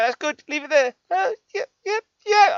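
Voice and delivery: high loud triumphant yelps; excited squeal. Real, not acted. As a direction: squeal with relief as it fits. Piece finally fits.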